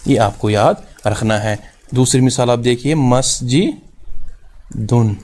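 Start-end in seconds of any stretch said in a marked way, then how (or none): 2.9-5.1 مسجدن very slowly, "masji", long pause, then "dun"; syllable-by-syllable demonstration. Shown as a demonstration. Teacher giving a second noon example, مسجدن slowly split "masji... dun". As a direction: break مسجدن before its last syllable and sound the final n. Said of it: "کیونکہ نون کی آواز آتی ہے".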